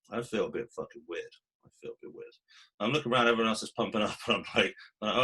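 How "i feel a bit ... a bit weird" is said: whispered, much softer thought; worried whisper fading to nothing; hushed inner worry. Retold or imitated. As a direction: mutter it to yourself, barely voiced. Panicked inner thought backstage.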